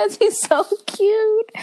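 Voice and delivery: high, slow swooning gush. Real, not acted. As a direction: swoon over him, drawn out. Gushing over a celebrity crush.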